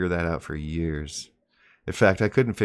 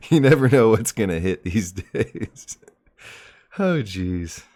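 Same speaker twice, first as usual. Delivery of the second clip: talking through laughter, louder; laughing; 5 dB louder than usual. Real, not acted. Host amused at the night's off-topic turn, laughing. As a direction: let the laugh break into the last words.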